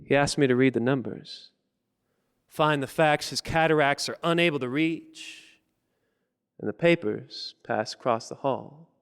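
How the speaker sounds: deliberate phrases with pauses, swelling in intensity; spoken-word poem. Acted. Poet reads his piece for George's funeral, measured building recitation. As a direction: recite rhythmically, drawing out key words.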